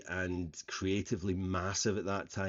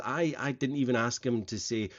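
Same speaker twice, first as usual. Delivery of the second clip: ordinary talk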